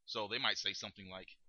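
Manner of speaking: ordinary talk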